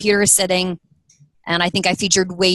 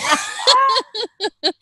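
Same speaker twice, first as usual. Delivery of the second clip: burst of laughter; 14 semitones higher than usual. Real, not acted. Laughing about the failed Friday.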